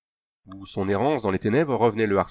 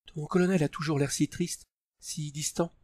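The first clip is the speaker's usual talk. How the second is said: put-on higher, softer young woman's voice; tender woman's voice, higher and soft; softly, Tania; 6 semitones higher, 5 dB quieter than usual. A narrator's reading. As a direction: voice Tania gently, higher and hushed. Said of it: "dit doucement Tania".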